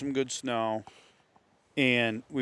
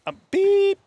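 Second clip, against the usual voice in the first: censor bleep; 9 dB louder than usual. Retold or imitated. Self-censoring a curse in retold rant.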